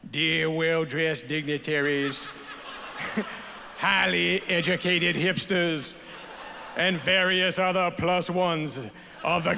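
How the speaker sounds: hammed-up Southern speechifying; goofy Southern accent. Dad reads his daughters' speech to the awards crowd, goofy Southern accent. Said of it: "goofy Southern accent you always do".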